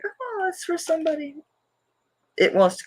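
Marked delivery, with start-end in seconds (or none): 0.0-1.4 high, excited squeal; giddy self-quote. Retold or imitated. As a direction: coo like you can't wait to give it away. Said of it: "You know, it's like".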